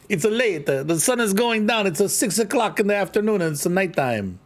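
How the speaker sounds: high-pitched mock Italian with extra syllables; hammed-up Italian accent. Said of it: "I don't know why I'm Italian all of a sudden".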